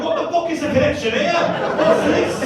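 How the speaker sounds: loud, high, incredulous; can't believe what he's hearing; a mock outburst of total confusion; baffled disbelief. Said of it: "I'm over here going".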